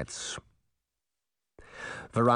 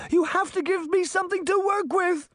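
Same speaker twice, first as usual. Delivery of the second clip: high, loud frantic cry; desperate shout; 3 dB louder than usual. A narrator's reading. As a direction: demand it in desperation. Shaking the mirror's frame.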